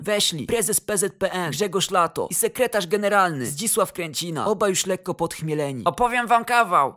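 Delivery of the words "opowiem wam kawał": higher, louder grinning offer; cheerful announcement. A narrator's reading. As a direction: announce it with a big grin. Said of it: "zaproponował od progu prezes Lato, uśmiechnięty". (wesoło, podniesionym głosem)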